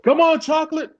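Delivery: very high heckler's call; imitated yell. Replaying the heckler at Symphony Hall, imitated yell. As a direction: urge the singer on from the crowd. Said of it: "Someone yelled out".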